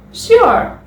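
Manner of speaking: bright, high, loud "sure"; eager yes. Acted. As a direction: jump at the chance to help. Eager reply to the favor.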